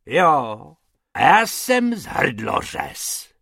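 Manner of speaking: loud, slow mock-fierce threat; hammed-up roar at the rabbits. A narrator's reading. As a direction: bellow like a cutthroat. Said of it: "zařval pan Hrubec".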